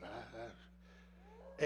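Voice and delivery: breathless, voiceless broken sobs; whispered sobbing. Shown as a demonstration. Veteran showing the congregation how he cried in bed, whispered sob. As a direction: let the words catch in a silent cry. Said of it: "yeah, just like that".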